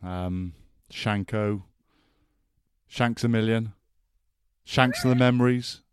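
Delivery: ordinary talk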